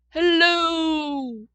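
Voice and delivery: loud hello as a far-off echo; echo calling back. A narrator's reading. Echo answering the child from the mountain, distant and loud. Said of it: "I heard a voice call back".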